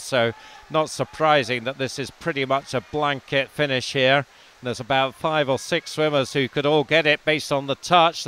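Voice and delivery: swimming commentary gathering pace and volume; live race call. Acted. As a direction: call the tight race as it unfolds, rising.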